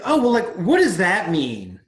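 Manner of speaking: loud, slowed-down baffled question; retold exasperation, heavily emphasized; exasperated questioning. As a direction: press the waiter for an answer, loudly. Acts out his exasperated questioning of waiter.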